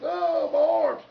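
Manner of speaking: very high, loud, slow pained wail; stuck-pig holler. Retold or imitated. Skier hollers in pain being pulled into boat, stuck-pig wail. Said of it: "holler like a stuck pig".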